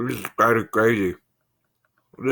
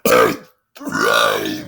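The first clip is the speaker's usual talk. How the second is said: a low burp carrying the words; 12 semitones lower, 4 dB louder than usual. Shown as a demonstration. Burp-talk challenge.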